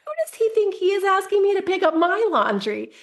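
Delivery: high, outraged mock indignation; indignant ego. Mom's inner voice, offended her son asks her to tidy up, indignant. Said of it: "bubbling at the surface that was like".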